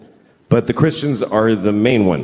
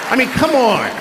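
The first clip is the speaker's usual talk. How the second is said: escalating comedy rant; 9 semitones higher than usual. Real, not acted. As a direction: build the rant to a peak.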